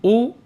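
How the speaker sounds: où called out high and loud; stressed où when looking for something; emphatic où. Shown as a demonstration. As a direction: pop où up like a call for a lost thing. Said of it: "then you can say".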